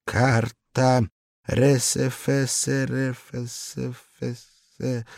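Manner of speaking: slow stammering, lips shaking; slow frightened stammer; trembling lips. A narrator's reading. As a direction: stumble through the letters in fear. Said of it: "прыгающими губами".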